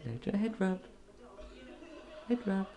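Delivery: high, light sing-song to a bird; pet coaxing. Real, not acted. Owner offering a parrot a head rub, high coaxing. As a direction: coax a pet gently up high.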